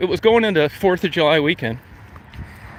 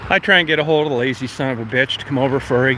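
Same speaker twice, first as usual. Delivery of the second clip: ordinary talk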